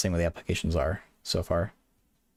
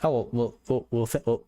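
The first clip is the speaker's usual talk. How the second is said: stumbling over repeated starts; real stuttering; 2 semitones higher than usual. Real, not acted. Streamer tripping over words while greeting viewers, stuttering. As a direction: trip on the word and keep restarting it. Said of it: "oh my god, I'm stuttering".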